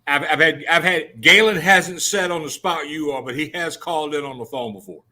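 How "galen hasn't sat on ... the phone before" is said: ordinary talk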